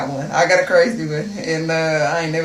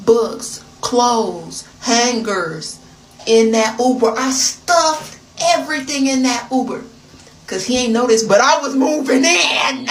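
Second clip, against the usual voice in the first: excited; 3 semitones higher, 8 dB louder than usual. Real, not acted. Building excited list.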